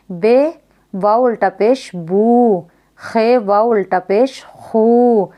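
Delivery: slow spelling, stretching बू, खू, सू into long oo; drawn-out maroof vowel. Shown as a demonstration. Teacher showing maroof to pupils, long stretched oo. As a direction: hold the oo in बू, खू, लू. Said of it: "इसको खींचकर पढ़ा जाएगा".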